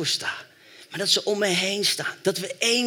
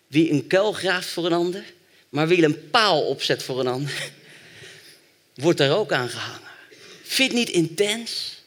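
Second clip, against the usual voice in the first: normal talking voice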